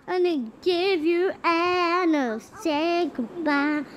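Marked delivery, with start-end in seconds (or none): none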